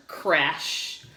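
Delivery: ordinary delivery, nothing marked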